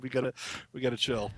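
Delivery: normal talking voice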